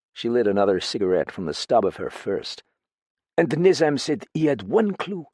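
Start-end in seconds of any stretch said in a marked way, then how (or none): 3.4-5.3 put-on higher, foreign-accented woman, fretful; frightened foreign woman confiding in a friend; lightly French-tinged voice, uneasy and pleading; accented woman character. Same character voice.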